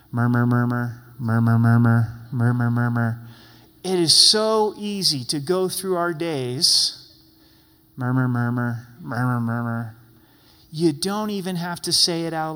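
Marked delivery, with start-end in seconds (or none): 0.1-3.3 low, loud grumbling murmur; mock grumbling drone. Shown as a demonstration. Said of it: "I like murmur 'cause it gives a good indication of complaining". 8.0-10.0 low, loud grumbling murmur; mock grumbling drone. Shown as a demonstration. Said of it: "I like murmur".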